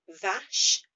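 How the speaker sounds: vache said slowly and loud, rising; clear French vache. Shown as a demonstration. Teacher modelling vache for the class, loud and clear. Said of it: "V H".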